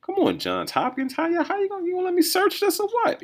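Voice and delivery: high exasperated complaint at the screen; exasperated plea. Real, not acted. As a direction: pitch jumps up, pleading with the website.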